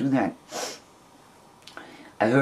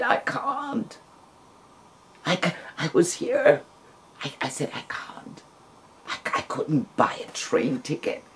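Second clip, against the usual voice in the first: breaking down; 3 semitones higher, 5 dB quieter than usual. Retold or imitated. Woman on the phone telling her friend she can't come, breaking. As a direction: choke out the refusal as it falls apart.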